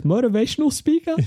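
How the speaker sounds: very high, questioning pitch; hopeful guess. Retold or imitated. Voicing the psychic's next guess.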